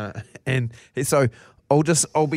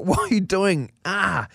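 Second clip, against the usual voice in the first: higher-pitched dad protest ending in "ah"; retold pained dad; 8 semitones higher, slower than usual. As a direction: act out stepping on sharp toys. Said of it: "And I was like".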